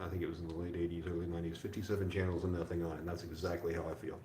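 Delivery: normal talking voice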